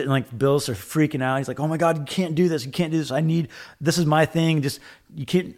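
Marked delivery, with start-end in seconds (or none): 1.6-5.5 lightly voiced panicked friend, a touch higher. Friend freaking out over a copied machine, mock pleading.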